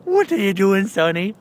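high, loud attempted Irish lilt; badly put-on Irish accent, high and loud. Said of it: "Why am I sounding, is that Irish?".

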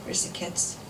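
soft, subdued question; low voice. A narrator's reading. Husband home late asking after children, low voice. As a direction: drop to a soft, drained murmur. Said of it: "His voice was low".

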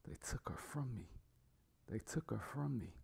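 hushed, near-whispered acted grief; reluctant crying, low. A narrator's reading. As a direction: softly whimper it out, holding back tears. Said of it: "He reluctantly cries and lowly says".

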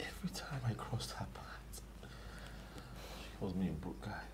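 soft, slow wounded whisper; hushed hurt. Acted. [name] repeats what the girl calls him, hushed and slow. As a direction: let the insult sting quietly.

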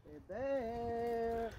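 high, drawn-out call to a bear; scaring off a bear. Real, not acted. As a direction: call out long to warn a bear. Hikers calling at a bear.

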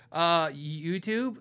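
slow, drawn-out unsure answer; caught unprepared. Retold or imitated. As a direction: grope for an answer as a question. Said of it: "didn't know to prep a second lie".

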